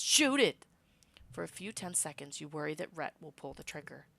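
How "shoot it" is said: loud, high panicked command; shouted order. Acted. The cowering governor screams at Rhett to shoot, loud. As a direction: yell for him to fire. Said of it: "he commands".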